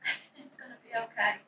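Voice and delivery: soft, hushed reassurance; whispered comfort. Acted. Pastor hugs the tearful daughter, whispering reassurance. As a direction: murmur it close, like a comforting hug.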